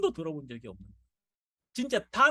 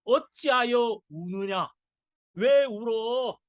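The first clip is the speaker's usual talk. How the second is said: loud, slow, ringing rebuke; preacher booming out why do you weep; Jesus rebuking; 3 semitones higher, 7 dB louder, slower than usual. Acted. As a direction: demand it of the mourners, loud and slow. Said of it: "예수님은 뭐라고 말씀하시냐면".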